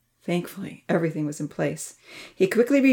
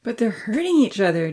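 higher-pitched worried protest; arguing; 4 semitones higher than usual. A narrator's reading. Dia argues against going to help, protesting. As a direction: object anxiously, voice pitched up. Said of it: "Dia argued".

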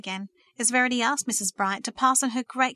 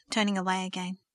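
ordinary delivery, nothing marked

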